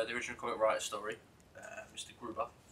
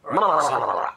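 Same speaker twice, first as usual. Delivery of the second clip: loud babble; 11 dB louder than usual. Pretend. Babbling nonsense loudly.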